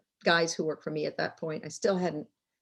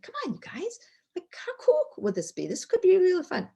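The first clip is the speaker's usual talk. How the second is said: high, softened retold coaxing; agitated pleading; 11 semitones higher than usual. Boss pitching an idea to her silent guys, agitated pleading. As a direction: act out trying to win over a silent room. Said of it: "I got a little more agitated".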